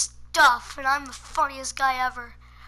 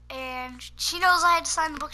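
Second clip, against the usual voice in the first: normal talking voice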